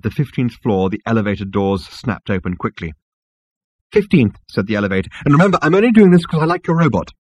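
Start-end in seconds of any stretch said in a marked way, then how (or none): 3.9-4.4 higher-pitched floor call; high, terse elevator voice; curt elevator announcement. A narrator's reading. Grudging elevator calls the floor. 5.2-7.1 fast, higher-pitched grudging reminder; high, hurried elevator voice. A narrator's reading. Elevator grudgingly does the favour.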